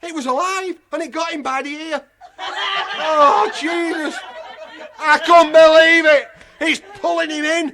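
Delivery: high, loud, laughing shouts; laughing uproar. Real, not acted. At the tortoise biting the dog, high laughing shouts.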